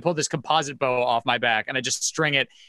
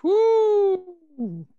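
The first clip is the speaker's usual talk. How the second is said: excited whoop. Real, not acted. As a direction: whoop with delight. Cheering a dice roll.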